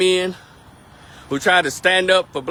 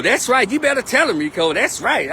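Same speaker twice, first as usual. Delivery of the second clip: higher, egging-on amen-corner voice; mock hype cheerleader; 7 semitones higher than usual. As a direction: play the fan shouting encouragement at Rico. Mock student cheering him on.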